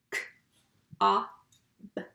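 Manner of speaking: K-A-B as three separate letter sounds; sounded out. Shown as a demonstration. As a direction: tap out cob one sound at a time. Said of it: "Let's tap cob".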